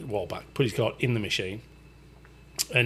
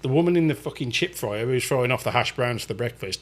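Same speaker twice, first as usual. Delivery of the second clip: normal talking voice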